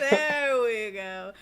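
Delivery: slow, loud, yawned words; said through a big yawn. Real, not acted. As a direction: let a yawn stretch the words. Yawned reply.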